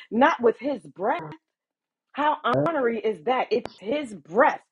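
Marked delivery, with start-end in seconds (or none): none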